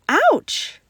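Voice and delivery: a sudden high, loud yelp of pain; sharp cry. Acted. Child hit by a paper plane yelps loudly in pain. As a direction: yelp as the plane hits you. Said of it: "I cried".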